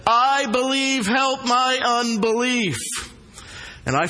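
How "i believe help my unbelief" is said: high, slow, pleading cry; cried out. Acted. Father begging Jesus to heal his child, crying out. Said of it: "cried out and said".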